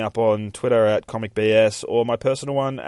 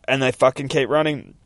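usual speaking voice

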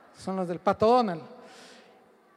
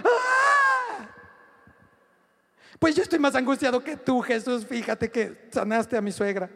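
mock whiny Pedro. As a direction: whine like you have it worse. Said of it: "Pedro no agarró y le dijo".

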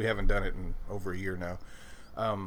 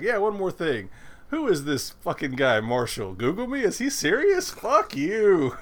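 raised, louder mock Joker rant, mocking the guest; a hint of the Joker; 8 semitones higher, 7 dB louder than usual. Host retelling the Joker roasting Marshall, hammed-up indignant. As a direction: sneer it like a lightly voiced Joker. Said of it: "even as the Joker, he's like".

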